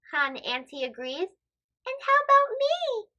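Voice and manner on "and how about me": put-on high, hopeful little girl; pleading girl voice. Farnaz asking her mother if she can fast, high hopeful voice. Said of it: "Farnaz asks".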